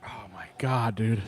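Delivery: hushed, awed whisper. Real, not acted. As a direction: breathe it out under your breath. Reacting to the hot sauce.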